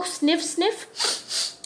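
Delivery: two breathy whispered sniffs; double sniff. Shown as a demonstration. Teacher acting Sniffles for the class, whispered sniffs. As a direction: sniff twice like a crying crocodile. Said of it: "used to go".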